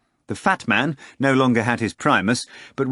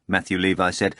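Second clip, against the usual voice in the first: plain reading voice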